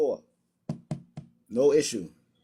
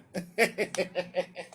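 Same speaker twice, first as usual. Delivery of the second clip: soft real laugh; 6 dB quieter than usual. Real, not acted. Laughing off opponent.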